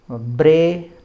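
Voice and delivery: ब्रे said loud with a long ay vowel; ब्रे held long and strong; Vedic pronunciation. Shown as a demonstration. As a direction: stretch ब्रे as bray, never bree. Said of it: "इसको ब्री नहीं पढ़ना है".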